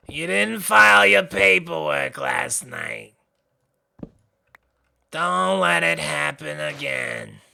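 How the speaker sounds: deadpan clerk scolding; Roz voice. Acted. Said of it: "Roz, that's her".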